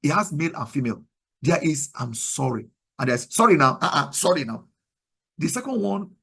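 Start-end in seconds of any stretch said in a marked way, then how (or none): 1.9-2.7 lower, softer, humble apology; broken, sincere apology. Shown as a demonstration. Demonstrating an apology that shows brokenness, humbly sincere. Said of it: "does not show brokenness, but the first one". 3.3-4.7 offhand sorry with a louder start; unrepentant apology. Shown as a demonstration. Showing an apology that lacks brokenness, dismissively. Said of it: "does not show brokenness".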